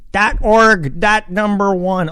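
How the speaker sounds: high, loud silly voice; silly high-pitched outburst; goofy high voice. Acted. Goofy outburst while typing address.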